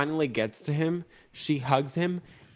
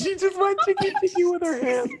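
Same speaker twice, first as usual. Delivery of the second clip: high squeaky laughing repeat; laughing high echo. Real, not acted. Echoing in a squeal.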